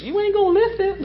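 high-pitched scoffing dad; dad impression. Preacher mimicking dad on buying weights, high-pitched disbelief. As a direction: pitch it way up, imitating a doubtful dad. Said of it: "That's how my dad would say it".

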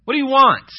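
loud, high-pitched, rude demand. Acted. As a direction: throw the question at them rudely. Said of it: "said it in a rude way".

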